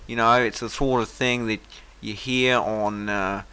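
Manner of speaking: over-the-top amateur Australian accent. Shown as a demonstration. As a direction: put on a broad Aussie accent. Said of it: "here goes the Australian one".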